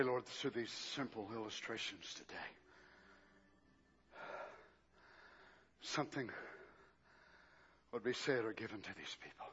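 soft, low prayer; quiet prayer. Acted. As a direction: pray gently to the Lord. Leads a prayer.

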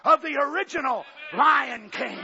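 shouted, high preaching; full-force preaching. Acted. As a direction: thunder it at the congregation.

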